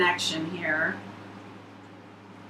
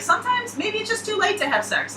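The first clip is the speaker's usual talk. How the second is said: much higher, light Harry impression; mock Harry; 12 semitones higher than usual. Reader quoting Harry to his friend Jess, a mild mock voice. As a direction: toss off the line like a weary Harry. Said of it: "and he's like".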